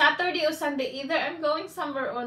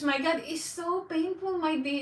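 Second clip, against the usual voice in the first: ordinary talk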